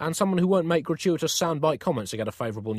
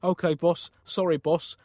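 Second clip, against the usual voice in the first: normal talking voice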